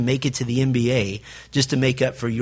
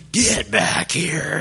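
mock frazzled mom; much slower than usual. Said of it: "Mom is just screaming".

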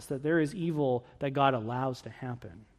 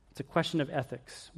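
softer emphatic repeat; 8 dB quieter than usual. Shown as a demonstration. Preacher restating the point to the congregation, softer. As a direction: question of ethics dropped softer, leaning on question. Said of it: "The next question is a question of ethics".

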